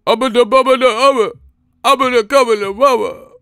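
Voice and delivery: high, loud mumbled gibberish rap, hammed up; mock a mumble rapper spewing nonsense; mumble rapper parody. Mocking new rappers' nonsense, high loud mumble rap. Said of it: "you saying that, but when we listen to it, it's shit".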